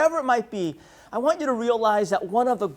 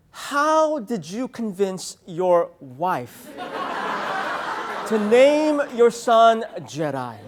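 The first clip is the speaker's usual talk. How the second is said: slow, drawn-out incredulous plea; awed young fan; 2 semitones higher, slower than usual. Retold or imitated. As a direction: beg like a disciple before a master. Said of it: "young adults who email me saying".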